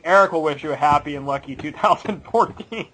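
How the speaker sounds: ordinary talk, nothing marked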